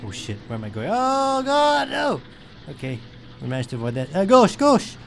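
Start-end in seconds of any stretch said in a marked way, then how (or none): 0.9-2.2 high, drawn-out wail of alarm; long high-pitched panic; panicked cry. Real, not acted. About to crash in game. 4.2-5.0 high, loud repeated command; frantic steering call; urgent shout. Real, not acted. Urgent steering to avoid crash.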